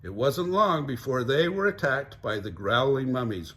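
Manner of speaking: ordinary delivery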